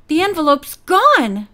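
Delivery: exclaiming. A narrator's reading. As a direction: exclaim it in surprise, pitch swooping on the last word. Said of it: "she exclaimed".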